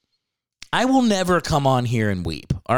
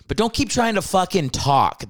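usual speaking voice

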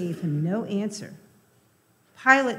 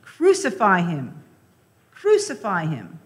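crowd shouting; 7 dB louder than usual. A narrator's reading. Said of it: "they shouted".

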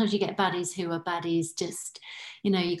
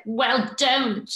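a clipped, higher, louder brush-off; curt put-down; 7 semitones higher, 6 dB louder than usual. Retold or imitated. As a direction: shut the request down coldly. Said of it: "And she went".

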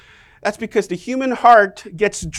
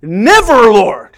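loud, forceful protest; indignant rebuke; 9 dB louder, slower than usual. Acted. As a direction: rebuke him hard. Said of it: "began to rebuke him".

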